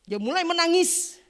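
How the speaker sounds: loud, high surge of emphasis; preaching outburst. Real, not acted. Preacher telling congregation of a man's breakdown, loud outburst. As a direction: belt it out to the congregation. Preacher climaxes his testimony.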